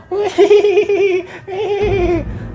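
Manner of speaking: a shrill, high possessed cackle that falls away; eerie laugh. Retold or imitated. The possessed girl laughing at the threat, eerie high cackle. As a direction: laugh like something else is laughing through her. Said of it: "Dia ketawa".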